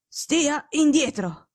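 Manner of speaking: drawn-out stern warning, slow; slow imperious command. Acted. Sick detective repeating the order, slow and stern. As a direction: drag each word out as a firm order. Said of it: "tono secco e imperioso".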